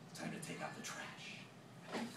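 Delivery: slow, breathy threat; menacing whisper. Acted. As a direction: whisper it like a hitman's promise. Mick's grim threat.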